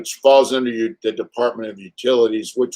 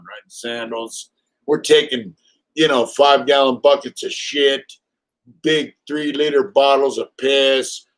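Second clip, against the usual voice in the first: ordinary talk, nothing marked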